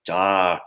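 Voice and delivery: Jack said low as "jahk", falling; accent grave. Shown as a demonstration. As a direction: sink Jack down on an open ah. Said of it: "imagine it's kinda like an accent grave".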